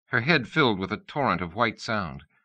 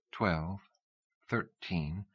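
soft, murmured count to herself; barely voiced numbers, for herself only; counting under her breath; 9 dB quieter, much slower than usual. A narrator's reading. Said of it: "counting under her breath".

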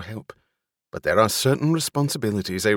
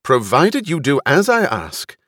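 loud, higher-pitched public address; commanding speech pitched up over a crowd; raised voice; 5 semitones higher, 6 dB louder than usual. A narrator's reading. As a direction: address a crowd with stern authority. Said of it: "She raised her voice".

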